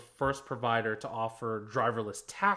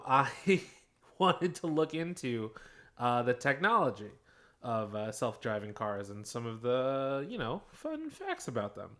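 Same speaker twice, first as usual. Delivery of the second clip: usual speaking voice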